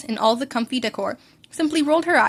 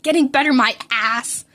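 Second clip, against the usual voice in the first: ordinary delivery, nothing marked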